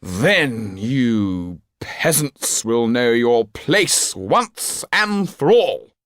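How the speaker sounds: put-on high, very slow drunken sneer; a drunk aristocrat gloating at his servant; drunken scorn. A narrator's reading. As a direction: lean into the butler, drunk and contemptuous. Drunk marquis lurches at butler.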